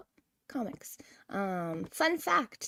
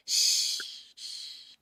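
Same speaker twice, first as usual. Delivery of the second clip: shushing. Pretend. Hushing to keep a secret.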